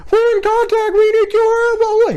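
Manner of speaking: very high squeaky panicked radio call; panicked new guy on the radio, mock Minnie Mouse squeak. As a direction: squeak it out like a scared rookie. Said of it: "He'd sound like Minnie Mouse on the radio".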